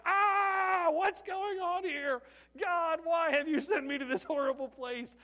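high, loud wail, easing slightly into a plea; panicked shout. Acted. Overwhelmed houseparent crying out to God, panicked shout. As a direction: yell in alarm, then cry out to heaven.

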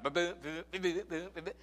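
voiced sputter of a sick engine; mock engine sputter. Telling the church how his broken car sounded, mock engine sputter. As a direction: mimic the car coughing along. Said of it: "it'd be like".

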